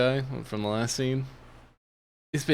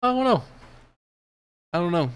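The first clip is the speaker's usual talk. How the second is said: much higher, sing-song I don't know; baffled; 7 semitones higher, faster than usual. Real, not acted. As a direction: shrug it off in a high voice. Baffled at casting choice.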